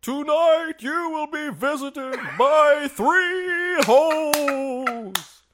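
high slow dramatic announcer; ghostly narrator voice. Acted. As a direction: intone it slowly like a ghost. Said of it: "What voice was that?".

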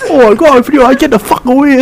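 high, loud mock of her shouting. Friend mimicking her recorded outburst, mock shouting. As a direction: imitate a high-pitched angry yell. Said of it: "like, the way I shouted".

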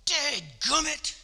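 loud, high, drawn-out grumble; hammed-up angry prophet griping; mock angry outburst. Jonah mad at God, spitting out a hammed-up curse. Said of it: "He's mad at God".

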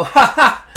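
loud, high imitated laugh; nervous chuckle. Patient hearing alarming news, imitated chuckle. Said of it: "I chuckle, I go".

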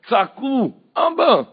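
loud, very high call for father; desperate cry. Acted. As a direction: cry out as if unable to pray. (זעקה נואשת בקול רם וגבוה)

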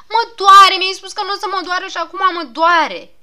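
put-on a child howling that it hurts; whiny loud complaint. Patient wailing at the doctor.

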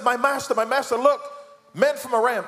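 high, loud panicked cry of "my master"; a terrified servant shouting a warning. Acted. Panicked servant sees army.

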